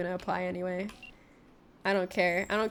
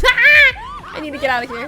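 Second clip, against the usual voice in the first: high, loud, slow shout with a laugh at the start; laughing panic, yelled high and loud; panicked shout; 15 dB louder than usual. Real, not acted. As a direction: scream to flee the chaos. Panic after fights erupt.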